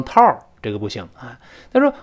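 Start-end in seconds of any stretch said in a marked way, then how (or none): 0.0-0.4 "套儿" as a mistaken one-syllable towel with curled r ending; wrong towel pronunciation. Shown as a demonstration. Teacher shows students the mistaken "套儿" for towel, mispronounced. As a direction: say "套儿" with the erhua r, no final L. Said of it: "哎，不能读成套儿".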